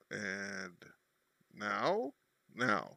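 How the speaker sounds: ordinary talk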